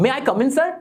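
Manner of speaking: "May I come in, sir?" with a questioning rise; polite question, left hanging and unfinished. Shown as a demonstration. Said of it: "मेरे बोलने के तरीके से पता चल रहा है कि मैं सवाल पूछ रहा हूं". ("May I come in, sir?" सवालिया लहजे में)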